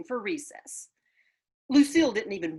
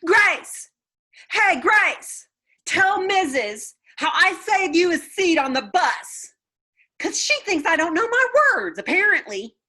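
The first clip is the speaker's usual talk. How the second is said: high, loud call across the room; shouting across room; 13 semitones higher, 9 dB louder than usual. A narrator's reading. Junie B calling Grace across the classroom, loud high shout. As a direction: call out to a friend far away. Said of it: "I shouted across the room".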